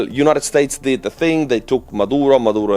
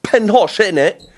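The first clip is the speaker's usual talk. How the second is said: Ben Hosh, innit, in a hammed-up Cockney accent, much higher; 10 semitones higher, 3 dB louder than usual. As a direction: play the London geezer, with Husk said as Hosh. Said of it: "the Cockney Arthur".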